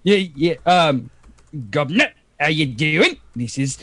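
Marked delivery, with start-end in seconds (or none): 1.7-3.8 low Cockney greeting; Cockney accent. Acted. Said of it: "uh, Cockney".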